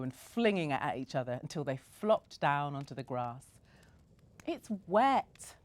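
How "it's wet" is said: put-on high, louder little-girl complaint; complaining child. A narrator's reading. Bella flopped on wet grass, high whiny complaint. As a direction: whinge about the wet grass. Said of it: "Bella complained".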